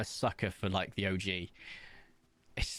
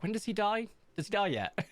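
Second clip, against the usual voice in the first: eager glee; 11 semitones higher than usual. Retold or imitated. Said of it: "I was like".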